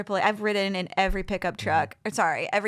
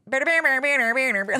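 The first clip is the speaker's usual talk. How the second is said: imitated twangy, unintelligible jabber; banjo-like mechanic; 4 semitones higher, 4 dB louder, much faster than usual. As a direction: mimic speech that sounds like banjo plucking. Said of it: "he sounds like a banjo".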